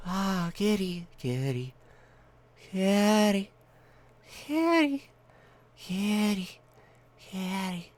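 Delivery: high, loud kitty calls; calling a cat. Real, not acted. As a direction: call out for the cat.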